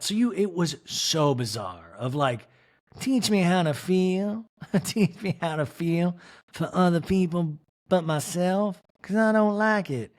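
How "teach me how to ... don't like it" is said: mock pleading. Quoting someone's plea.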